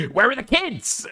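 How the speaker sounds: playing at sounding like Queeman; mock Queeman. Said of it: "Now I sound like Queeman".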